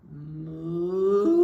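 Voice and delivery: deep, long moo building in volume; low drawn-out cow call, swelling. Acted. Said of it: "I heard a".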